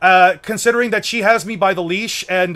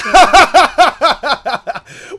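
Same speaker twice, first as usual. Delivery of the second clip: loud laugh; 7 semitones higher, 9 dB louder than usual. Real, not acted. Laughing at the chat's joke.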